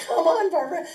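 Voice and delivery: loud, high mock yell of a husband; loud retold nagging; husband's urging. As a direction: imitate an exasperated husband prodding his wife, full volume. Acting out husband urging her.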